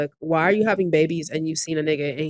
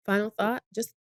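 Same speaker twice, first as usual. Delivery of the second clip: ordinary talk, nothing marked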